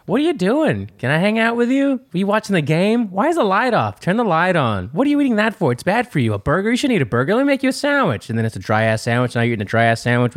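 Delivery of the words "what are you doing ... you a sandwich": high-pitched nagging girlfriend impression; mock clingy wife, pitched way up. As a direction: pester with question after question in falsetto. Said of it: "your wife or your girl comes up".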